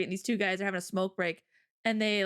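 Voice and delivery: usual speaking voice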